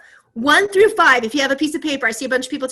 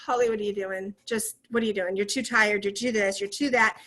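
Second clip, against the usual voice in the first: soft, nagging self-talk; quietly voicing her inner critic; 4 semitones lower, 7 dB quieter than usual. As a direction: mutter the doubts to yourself. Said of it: "When I'm feeling like".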